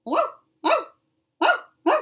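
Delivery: very high, loud puppy yips; puppy yipping. Shown as a demonstration. Reader retrying the professor's bark, high loud yips. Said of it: "that doesn't sound like yipping".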